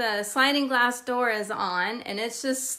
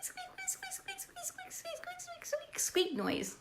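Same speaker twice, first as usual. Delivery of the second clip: tiny high squeaks, much softer; imitated bat squeaks; 13 dB quieter than usual. Shown as a demonstration. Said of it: "the only way I can re-, make it sound close".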